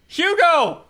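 loud, high, delighted shout; excited call. Acted. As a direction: call out to him gleefully. Player calls out to Hugo.

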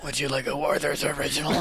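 creepy voice selling candy; sinister ad pitch; grim reaper voice. Acted. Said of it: "I'm gonna keep murder my voice".

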